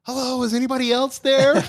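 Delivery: high, loud, panicked call to anyone else; a hiker rejecting God's answer, shouting up; high loud frantic shout; panicked punchline. Acted. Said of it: "And the guy goes".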